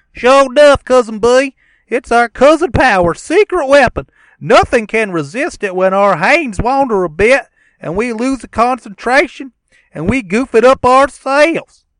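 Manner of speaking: very high, loud giggling yokel; silly cousin voice. Acted. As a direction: squeal it like a dim cousin. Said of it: "we can do a dramatic reading".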